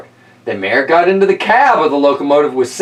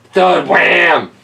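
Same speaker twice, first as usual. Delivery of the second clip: plain reading voice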